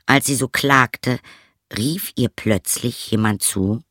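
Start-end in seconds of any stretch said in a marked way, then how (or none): none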